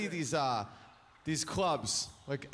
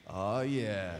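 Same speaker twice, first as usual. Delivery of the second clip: lower, gruff pleased "oh yeah"; low satisfied dude; 7 semitones lower than usual. Retold or imitated. Crowd of men reacting with low approval. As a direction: grunt it like a contented dude. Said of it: "dudes in the crowd just went".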